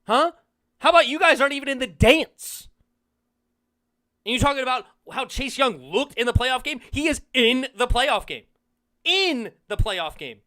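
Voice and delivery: loud, high, jeering shouting; heated comeback. Real, not acted. Firing back at the rival's video, loud jeering shouts. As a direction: shout him down, mocking.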